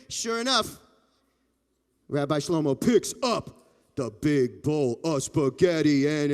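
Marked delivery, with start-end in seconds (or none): none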